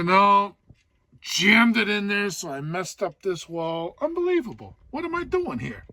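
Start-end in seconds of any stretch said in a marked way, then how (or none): 4.0-5.8 exasperated disbelief. Real, not acted. Exasperated over cracked shelf.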